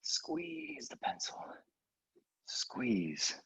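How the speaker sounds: breathy whispered cue. Real, not acted. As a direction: whisper it, slow and tight.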